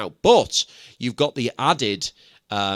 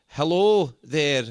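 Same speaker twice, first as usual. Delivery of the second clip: slow, drawn-out mock Scottish greeting; Scottish accent; 3 semitones higher, much slower than usual. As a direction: stretch it out like a Scot saying hi. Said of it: "so we got to say".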